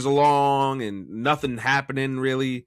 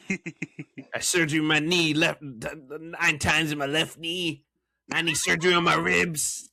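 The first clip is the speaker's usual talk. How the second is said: higher, slow whiny wrestler voice; slow hurting whine, lightly voiced; Rey Mysterio mocking voice; 4 semitones higher than usual. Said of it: "make fun of him with that voice".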